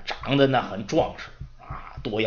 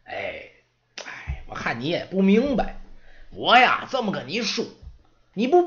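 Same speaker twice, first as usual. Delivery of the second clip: put-on know-it-all old servant; 3 semitones higher than usual. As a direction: explain it to the kid like a wise old-timer. Moros lectures the apprentice.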